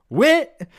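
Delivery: high, loud call up a staircase; calling up the stairs. Acted. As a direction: shout his name up the stairs. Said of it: "Connie called from the bottom of the stairs".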